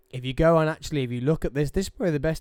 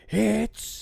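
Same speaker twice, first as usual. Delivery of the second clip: announcer voice; 9 semitones higher than usual. Shown as a demonstration. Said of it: "so I'm like".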